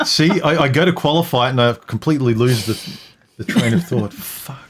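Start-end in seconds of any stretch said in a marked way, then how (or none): 4.1-4.7 under-breath swear; whispered curse. Real, not acted. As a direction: mutter it under your breath. Frustrated at forgetting.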